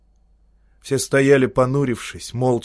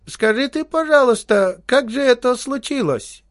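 put-on high, sweet wheedling old man's voice; a syrupy high old codger begging for gossip; sugary old man; 12 semitones higher, 3 dB louder than usual. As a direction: coax him like a fawning little old man. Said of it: "заговорил первый слащавым голосом".

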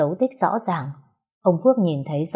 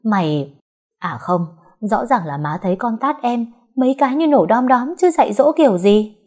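rising higher and louder; angry outburst; 5 semitones higher, 4 dB louder than usual. Acted. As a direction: accuse her furiously. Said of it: "Bà Lan tức mình lớn tiếng".

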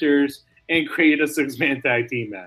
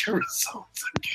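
fast, unvoiced aside; joking whispered confession; 9 dB quieter than usual. Real, not acted. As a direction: whisper it quickly with a laugh. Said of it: "And I probably won't do it".

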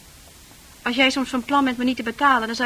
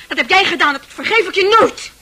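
louder and higher, hurling the blame; shouting her wounded pride at him; furious accusation; 6 semitones higher, 8 dB louder than usual. Acted. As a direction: make him feel every word, building anger. Accusing him, rising anger.

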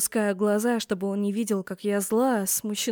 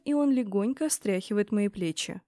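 ordinary delivery

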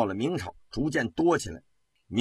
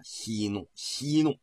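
冷笑; 3 semitones lower, 5 dB quieter, slower than usual. A narrator's reading. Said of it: "冷笑了一声".

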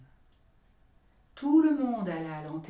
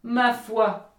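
louder, higher, annoyed burst; angry; 5 semitones higher, 10 dB louder than usual. Acted. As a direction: snap it out in anger. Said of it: "d'un ton colère".

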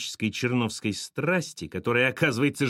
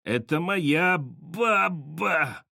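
slow, strangled croak, pitched up; hoarse wheeze; 5 semitones higher, much slower than usual. A narrator's reading. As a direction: wheeze it out word by word. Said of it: "прохрипел Петька".

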